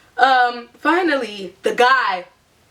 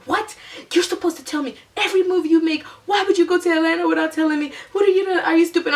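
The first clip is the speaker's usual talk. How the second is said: higher, scolding mom voice, a mild mock yell; 8 semitones higher, faster than usual. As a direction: play the furious mother scolding. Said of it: "are you gonna yell at me".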